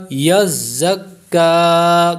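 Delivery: یزکا recited slowly with a firm za joined to kaf; tajweed recitation. Shown as a demonstration. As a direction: press za firmly into kaf in یزکا. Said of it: "کاف باریک ہوگا".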